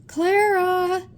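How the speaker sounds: high, loud cry for Clara; desperate shout. A narrator's reading. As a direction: call out for her with all his breath. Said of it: "tried to shout".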